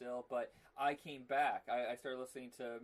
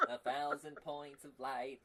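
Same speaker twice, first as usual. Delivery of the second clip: George Bush impression; slower than usual. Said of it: "I can go from George Bush".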